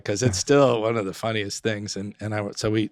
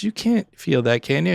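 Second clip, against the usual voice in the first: retold disbelief; 6 semitones higher than usual. Nurse doubts paralysed patient.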